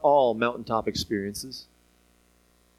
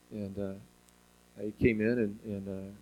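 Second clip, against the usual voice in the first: much softer, heavy recollection; grief-laden quiet; 6 semitones lower, 8 dB quieter than usual. Real, not acted. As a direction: let the loss weigh on each word. Recalling the miscarriage.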